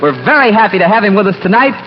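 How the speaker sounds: usual speaking voice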